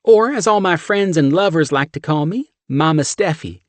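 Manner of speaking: high, loud brassy Southern woman; thick Southern accent. A narrator's reading. Steph shoving chili at John, thick Southern accent. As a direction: push the chili on him, Southern.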